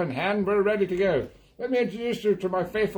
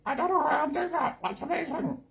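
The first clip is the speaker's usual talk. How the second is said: high, gargled amazement; while gargling; 10 semitones higher than usual. Real, not acted. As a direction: gargle through the words. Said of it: "I'm gargling".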